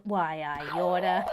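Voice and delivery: slow, mock-menacing old-movie threat. A lightly voiced comic threat answering a viewer's teasing. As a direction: shake a fist at her in jest. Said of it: "her Irish accent is beyond diabolical".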